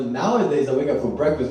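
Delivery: ordinary talk, nothing marked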